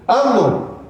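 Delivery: loud, high command falling at the end. Retold or imitated. Preacher re-enacts the order to do it, commanding voice. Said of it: "con voz".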